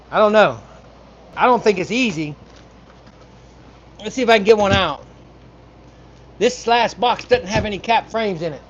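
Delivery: projecting loudly to a far mic; yelling across the room. Real, not acted. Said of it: "I'm sitting here yelling across the room".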